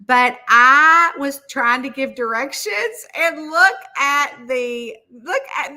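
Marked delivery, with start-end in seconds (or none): none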